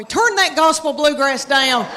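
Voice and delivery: mock yelling at a teenage son to turn it down. Mom hollering at her boy to turn the music down, mock yell. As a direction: scold like an exasperated mom, half joking. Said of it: "yell at my boy".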